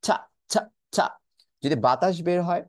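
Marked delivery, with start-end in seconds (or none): 0.0-1.1 ছা with a strong puff of air; aspirated t sound. Shown as a demonstration. Teacher demonstrating the puff of air on ছা. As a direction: push ছা out with a burst of breath. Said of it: "এই সাউন্ডটাকে ধাক্কা দিয়ে বের করতে হবে".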